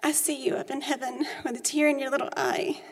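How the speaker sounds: reading her grief poem to the church. Acted. Reads her own poem aloud.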